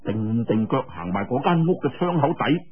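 ordinary delivery

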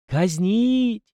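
high, drawn-out mocking echo; grim irony. A narrator's reading. Captain echoes the boy's demand to execute, grimly ironic. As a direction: stretch it out in dark irony. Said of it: "с мрачной иронией протянул сотник".

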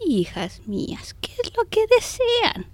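put-on slow, quavering old nun, voice rising high. Plump hunchbacked nun greets the two women, slow and old. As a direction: drag it out like a creaky old sister.

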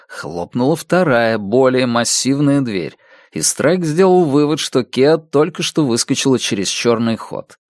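plain reading voice

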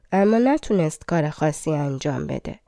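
ordinary delivery, nothing marked

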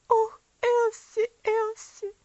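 put-on a sister wails over the dying girl; sobbing. A narrator's reading. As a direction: cry out through sobs. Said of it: "безутешно разрыдавшись, она воскликнула".

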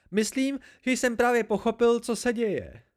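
loud outburst held high in pitch; high hysterical voice. A narrator's reading. Bast answering what is happening, high and hysterical. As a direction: keep it high and worked up, close to hysteria. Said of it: "vybuchl Bast vysokým, hysterickým hlasem".

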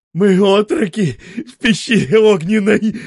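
joyful announcement. A narrator's reading. Merchant joyfully telling the narrator. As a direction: announce it with glee. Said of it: "радостно сообщает".

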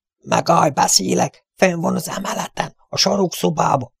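high, frantic begging; a cornered bandit giving in; desperate bandit. Acted. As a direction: blurt it out in panic to save his skin. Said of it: "kétségbe esetten hördült föl".

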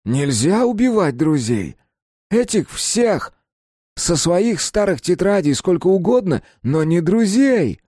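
high, loud, drawn-out pleading outrage; distraught protest. A narrator's reading. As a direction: cry out in indignant disbelief. Kapustin protests to his friend.

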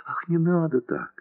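high, soft, moaning cry; moaning plea. A narrator's reading. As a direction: moan it out in distress. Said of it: "простонала она".

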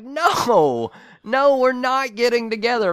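high, loud incredulous no; emphatic refusal. Retold or imitated. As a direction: shut the caller down loudly. Retold emphatic refusal to caller.

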